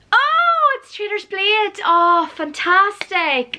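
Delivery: high, bright squeal of joy over a book; gush, thrilled to see it; delighted. Real, not acted. Unwrapping a wanted book.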